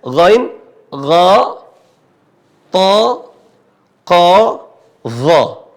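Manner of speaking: gha, to, ko, dha each said heavy and rounded; thick letters. Shown as a demonstration. Teacher lists the seven heavy letters aloud. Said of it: "disebut dengan isti'la".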